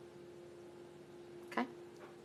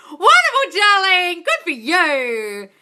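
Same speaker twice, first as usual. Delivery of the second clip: high, loud gushing, last words slow; excited praise; 10 semitones higher, 9 dB louder, much slower than usual. Real, not acted. Praising a viewer who feels confident, high loud gushing. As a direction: gush over the viewer.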